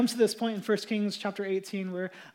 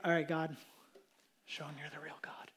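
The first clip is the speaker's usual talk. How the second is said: whispered, much softer plea; hushed prayer; 8 semitones lower, 13 dB quieter than usual. Retold or imitated. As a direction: breathe a confident prayer. Elijah's quiet prayer.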